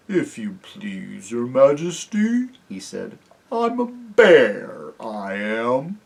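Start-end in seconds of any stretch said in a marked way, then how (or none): 0.1-2.5 very slow, drowsy creature voice, rising at the end; thick-tongued, drawn-out bear voice; thick, sleepy bear. A narrator's reading. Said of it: "a thick, sleepy voice said". 3.5-6.0 dopey, drawn-out creature voice; thick, sleepy bear. A narrator's reading. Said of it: "a thick, sleepy voice said".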